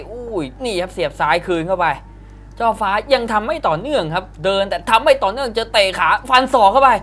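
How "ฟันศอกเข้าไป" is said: excited shout. Real, not acted. Live fight call, elbow lands.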